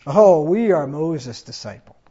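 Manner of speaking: slow haughty mock; reviling sneer. Pharisees reviling the blind man, slow haughty sneer. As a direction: lift your nose and sneer it. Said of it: "They reviled him".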